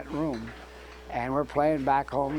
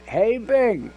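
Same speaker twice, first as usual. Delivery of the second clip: loud, high call down the hall; retold holler; 8 semitones higher, 8 dB louder than usual. Guitarist calling the bandleader back, loud retold holler. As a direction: holler after someone walking away. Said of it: "And I hollered again".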